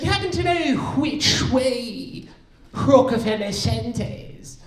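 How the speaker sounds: high, drawn-out mock British; British accent. Stranger asking for directions to Rockefeller Center, mock British accent. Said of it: "if you guys know any British people".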